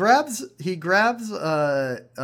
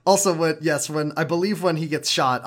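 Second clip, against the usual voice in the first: usual speaking voice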